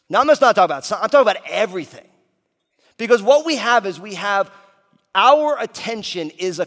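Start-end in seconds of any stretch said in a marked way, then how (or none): 0.1-5.6 booming motivational speech. Acted. Punches the point home.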